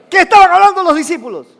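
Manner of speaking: high, fast, loud demand; urgent shouted question. Real, not acted. Preacher asks the congregation a further time, urgent shout. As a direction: press hard for an answer.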